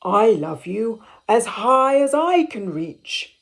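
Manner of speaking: a hint of a proud father; gently voiced big father hare.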